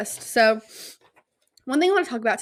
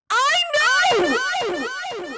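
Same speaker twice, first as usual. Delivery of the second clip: much higher, louder mock wail; wailing I know; 14 semitones higher, 4 dB louder than usual. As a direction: cry it out in hammed-up despair. Told he doesn't like her.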